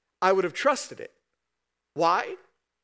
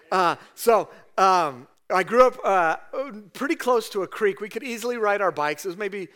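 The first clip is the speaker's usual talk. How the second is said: ordinary talk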